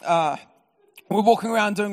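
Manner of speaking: normal talking voice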